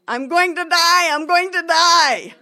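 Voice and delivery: loud, high panicked cry; frantic shout of doom. Retold or imitated. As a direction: yell it as if you're sure you'll fall. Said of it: "In my mind, I was shouting".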